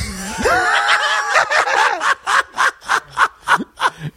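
high howling laughter; long loud laugh. Real, not acted. Teller laughing hard at the sign from God, long laugh.

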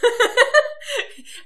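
sharp high burst of laughter; high loud laugh. Real, not acted. Co-host cracking up at her joke, high loud laugh.

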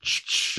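voiceless "shh, shh"; retold shushing. Podcaster acting out film characters' reply, mock shushing. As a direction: imitate a quick double shush.

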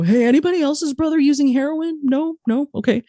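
high, light mock-casual ask; mock awkward question. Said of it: "go to your friends and go".

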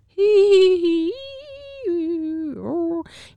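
ghost wail. Pretend. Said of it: "You do a spooky noise".

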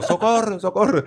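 high Spanish-accented "socorro", cried out twice. Shown as a demonstration. Showing friends it was the Spaniard's version, high accented cry. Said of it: "é o "socorro".

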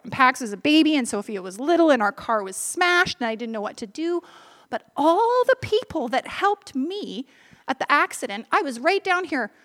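ordinary delivery

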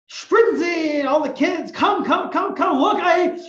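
high, loud joyful call to the family; excited call. Acted. Man calling his family to come, excited shout. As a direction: shout the good news to them.

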